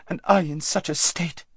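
high, fretful complaint; self-pitying whine. A narrator's reading. Lazareline complaining to her friend, self-pitying. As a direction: whine it, feeling sorry for yourself.

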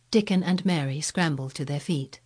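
ordinary delivery

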